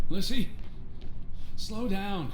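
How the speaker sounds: loud, high shouting into distance; calling out. Acted. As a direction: yell after the running girl. Calling after Lissy outdoors.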